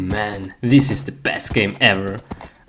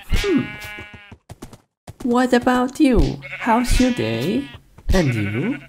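much higher, cutesy voice to the animal; talking to a sheep; 9 semitones higher than usual. Pretend. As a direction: coo at the sheep like a pet. Chatting to a sheep.

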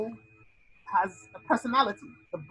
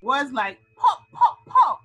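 pop, pop, pop popped out high and loud; popping sounds; 4 semitones higher, 7 dB louder, slower than usual. Shown as a demonstration. Teacher acting out onomatopoeia, loud popping sounds. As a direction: make the pop sound itself. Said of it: "words that sound like they are".

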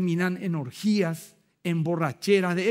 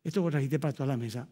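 low, soft aside to a brother; said quietly aside; 6 semitones lower, 6 dB quieter, faster than usual. Retold or imitated. Pastor quietly telling a greedy brother at the table, hushed aside. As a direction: murmur it discreetly, fast and hushed. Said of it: "le digo al costado".

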